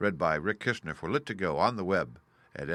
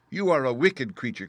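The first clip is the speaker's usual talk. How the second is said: put-on high, loud indignant child; angry cry; 5 semitones higher, 6 dB louder than usual. A narrator's reading. Dorothy crying out at the witch in anger. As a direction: cry it out at the villain. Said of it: "cried Dorothy".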